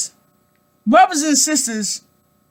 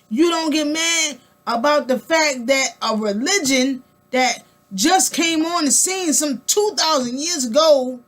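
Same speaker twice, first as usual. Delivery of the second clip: ordinary delivery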